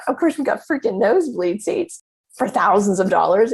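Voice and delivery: normal talking voice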